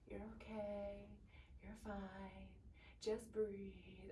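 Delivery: very soft, hushed imitation of reassurance; soothing nurse. Mother imitates nurse petting her arm, hushed soothing voice. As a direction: coo it gently under your breath, with pauses between. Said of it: "she's, like, petting my arm".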